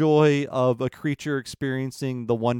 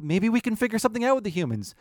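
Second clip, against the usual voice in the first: higher, quick hopeful wish; hopeful animals; 8 semitones higher, faster than usual. Retold or imitated. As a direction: dangle the hope lightly.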